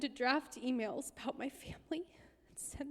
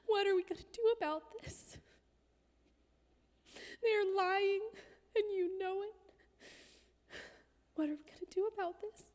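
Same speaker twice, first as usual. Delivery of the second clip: pitched-up, pressing demand; retold angry demand; 8 semitones higher than usual. Confronting her pastor over the lying email, pitched-up angry retelling. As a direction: push the question at him, higher and insistent.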